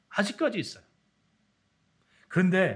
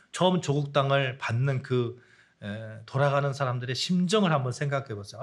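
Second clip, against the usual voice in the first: ordinary talk, nothing marked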